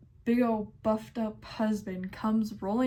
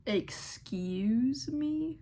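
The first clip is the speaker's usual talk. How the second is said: slow, drawn-out offended excuse me; 4 semitones higher, much slower than usual. Retold or imitated. Cashier stunned by a rude customer, slow and offended. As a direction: let the disbelief stretch out slowly. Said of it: "I'm over here like".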